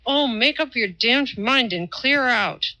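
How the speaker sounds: impatient snap at a pest. A narrator's reading. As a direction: bark it out through clenched teeth. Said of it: "With the string in his teeth".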